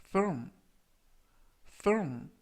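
firm said with f and a held "urm"; pronunciation demo. Shown as a demonstration. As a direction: model firm for learners to repeat. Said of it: "firm이라고 발음하시면 되겠습니다". (발음 시범)

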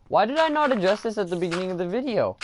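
sudden high, fast self-scolding burst; panicked realization. Real, not acted. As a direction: blurt it out as it dawns on you. Realizing chickens mistake, exclaiming.

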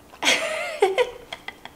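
squealing burst of laughter; high giggle. Real, not acted. High laugh after joke.

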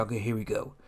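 low, hushed mock villain voice; softly voiced cartoon conspirator handing over a job; shady deal. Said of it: "Mars Girl slides some money across".

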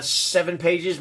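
ordinary talk, nothing marked

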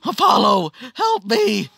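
losing captain calling to his god, acted high panicked yell; screaming for help. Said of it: "he would start screaming for help".

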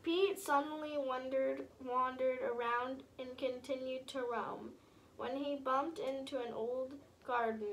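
usual speaking voice

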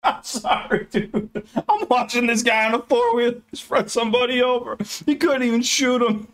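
high giggly voice, laughing through the words; can't stop laughing at the game; laughing while talking. Real, not acted. Laughing at a game run-over.

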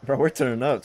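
hyped. Real, not acted. Hyped after kills.

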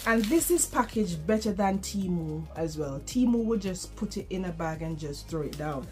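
usual speaking voice

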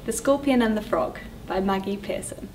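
usual speaking voice

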